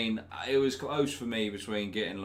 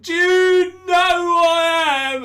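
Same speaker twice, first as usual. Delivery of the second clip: put-on much higher, louder, slow drunken bluster; drunk celebrity; 15 dB louder, slower than usual. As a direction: ham up a smashed Z-lister demanding respect. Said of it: "he was just like".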